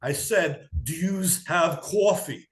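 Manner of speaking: hammed-up New Yorker saying "yous" and "cawfee"; heavy New York accent. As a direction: lay the New York accent on thick, slowly. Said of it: "leaned into the New Yorker".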